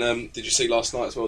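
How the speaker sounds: ordinary delivery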